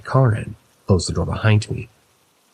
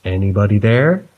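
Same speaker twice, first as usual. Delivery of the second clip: calling out; 4 dB louder than usual. Retold or imitated. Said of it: "I call out again".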